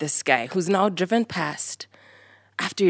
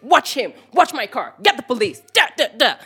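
mock screamed instructions; 5 semitones higher than usual. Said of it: "Starts screaming instructions".

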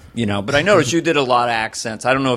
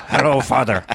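put-on toddler with an action hero's Irish voice; grave, put-on Irish greeting; Liam Neeson. Youngest son answering dad, Liam Neeson voice. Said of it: "Liam Neeson".

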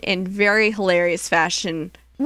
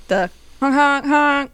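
Morse code honks; 6 semitones higher, 3 dB louder, slower than usual. Shown as a demonstration. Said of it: "the Morse code for X".

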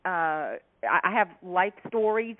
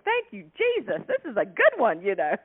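rejoice like a comic who struck gold; delighted inner thought; 3 semitones higher, 3 dB louder than usual. Retold or imitated. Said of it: "I'm going".

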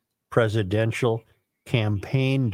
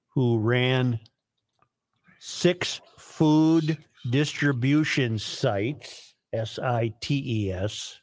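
dictating; slower than usual. Real, not acted. Dictating with spelling.

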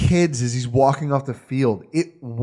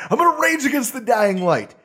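fiery rage voice; 8 semitones higher than usual. Retold or imitated. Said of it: "rage against the di".

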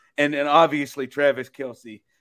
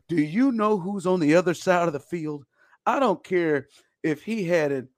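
normal talking voice

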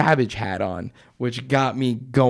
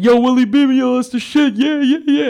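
much higher, excited fan voice; hyped stranger; 11 semitones higher, 5 dB louder than usual. Retold or imitated. Stranger praising Nick's Willie Beeman shirt, hyped.

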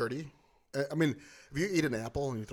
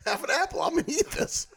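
high, chirpy voice for a hungry passerby; happy homeless guy; 13 semitones higher than usual. Retold or imitated. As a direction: squeal it out, delighted. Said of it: "He's like".

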